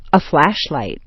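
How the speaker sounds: sharp stress on "flash" in "flashlight"; "flash" peaks, "light" drops away; first noun stressed. Shown as a demonstration. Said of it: "first one is sharply stressed".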